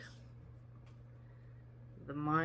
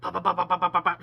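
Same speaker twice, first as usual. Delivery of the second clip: loud, quick mouth pops; playful pop-pop gun sounds; gunshot noises; 10 dB louder, much faster than usual. Retold or imitated. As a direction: rattle off imitated gunfire. Imitating gunshots at feet.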